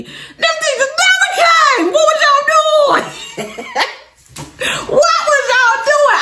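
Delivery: high, laughing half-shout; laugh-yell in disbelief. Real, not acted.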